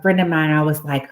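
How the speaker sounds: usual speaking voice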